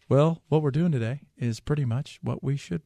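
lightly voiced, low and mild Pence; barely-there take on Pence, droning on; Pence impression. Radio host mimics Pence for co-host, lightly voiced and low. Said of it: "Mike would be like".

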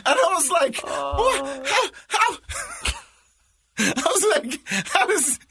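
can barely get the words out for laughing. Real, not acted. Dad reliving his reaction to the son, high helpless laughter.

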